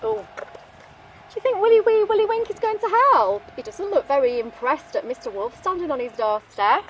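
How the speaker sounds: ordinary delivery, nothing marked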